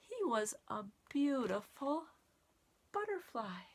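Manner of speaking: lively storybook read-aloud with counting; reading to children. Acted. Teacher reading the caterpillar book to children, lively storytime voice. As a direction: read it like storytime for little kids.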